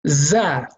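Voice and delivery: za with an open a; Arabic letter za. Shown as a demonstration. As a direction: say za with a light open vowel. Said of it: "Right under, under it is".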